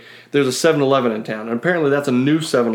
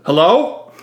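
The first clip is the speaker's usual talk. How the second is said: questioning hello, higher and louder; imitated phone answer; 6 semitones higher, 5 dB louder than usual. Stranger in the next stall takes a call, imitated loud phone answer.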